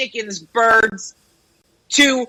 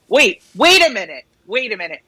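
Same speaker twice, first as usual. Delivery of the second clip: normal talking voice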